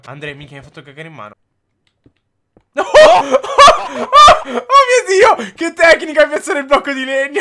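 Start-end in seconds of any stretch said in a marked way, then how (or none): 2.7-7.4 high, loud laughing yell of disbelief; real amazed shout; excited shout. Real, not acted. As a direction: scream with joy at the save. Teammate saves himself in game.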